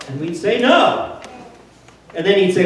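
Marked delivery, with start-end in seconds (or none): 0.6-1.2 a crowd yelling back No; crowd shout. Retold or imitated. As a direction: shout the answer like a bar crowd. Congregation voices crowd answering No.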